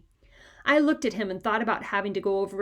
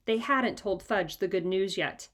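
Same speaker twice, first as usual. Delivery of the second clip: usual speaking voice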